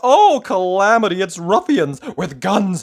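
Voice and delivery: high, loud frantic babbling; panicked squeal. Acted. Mister Jelly imagining a lion, in rising panic. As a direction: spiral into hysterical panic.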